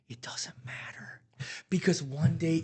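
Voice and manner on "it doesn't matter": breathy whispered aside; hushed and slow. Real, not acted. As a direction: drop to a whisper, almost unvoiced.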